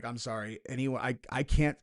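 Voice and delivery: ordinary talk, nothing marked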